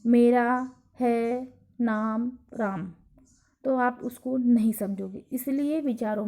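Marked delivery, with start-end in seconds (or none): 0.1-2.9 very slow, each word set apart; jumbled word order, slow. Shown as a demonstration. Teacher shows a confused sentence, slowly spoken. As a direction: drag out the muddled order. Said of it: "लेकिन इसको ऐसे बोला जाए".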